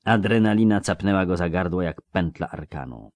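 plain reading voice

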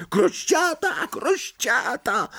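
very high, drawn-out taunt; slow, piercingly high-pitched teasing cry; gleeful shrieking. A narrator's reading. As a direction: shriek it like a jeering imp. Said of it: "wrzeszcząc".

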